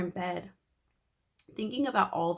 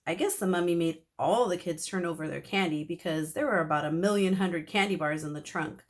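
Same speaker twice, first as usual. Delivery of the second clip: ordinary delivery, nothing marked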